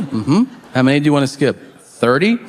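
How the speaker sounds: normal talking voice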